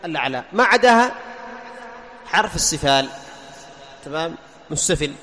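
ordinary talk, nothing marked

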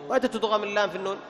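normal talking voice

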